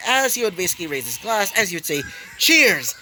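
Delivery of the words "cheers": loud, high, falling call; rousing toast. A narrator's reading. Host raises his glass to everybody, rousing loud toast.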